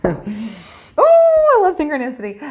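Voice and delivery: high, loud squeal of delight; delighted burst. Real, not acted. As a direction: blurt it out, thrilled. Delighted outburst mid reading.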